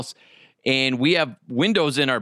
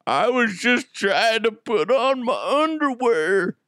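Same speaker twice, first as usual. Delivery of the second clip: sheepish high voice; 6 semitones higher, slower than usual. Retold or imitated. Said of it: "And I'm like".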